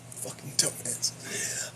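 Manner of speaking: slow whispered insult; muttered whispered jab; whispered aside. Real, not acted. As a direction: hiss it under your breath.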